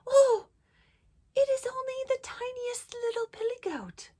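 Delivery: tiny, high-pitched little goat; very small voice. A narrator's reading. Littlest goat answering the troll, very small high voice. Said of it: "in his very small voice".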